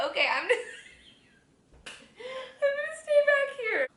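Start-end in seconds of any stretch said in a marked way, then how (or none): 2.6-3.9 very high squealing laugh. Real, not acted. Hiding from the camera in embarrassment, squealing with laughter.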